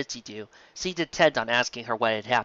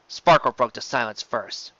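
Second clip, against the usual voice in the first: plain reading voice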